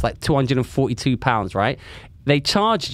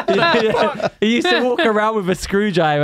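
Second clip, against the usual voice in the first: laughing; 7 semitones higher, 4 dB louder than usual. Real, not acted. Laughing at the memory.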